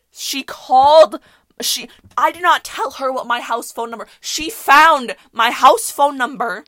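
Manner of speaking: loud, slow outraged emphasis. Retold or imitated. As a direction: stretch it out, loud and indignant. Outraged retelling.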